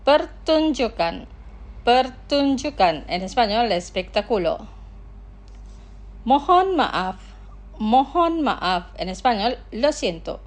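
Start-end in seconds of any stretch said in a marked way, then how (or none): 0.1-3.0 drawn-out, syllable-by-syllable model; slow pronunciation. Shown as a demonstration. Teacher models words for listeners to repeat, slowly. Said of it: "Los pronunciaré lentamente". 6.2-8.9 drawn-out, syllable-by-syllable model; slow pronunciation. Shown as a demonstration. Teacher models words for listeners to repeat, slowly. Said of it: "Los pronunciaré lentamente".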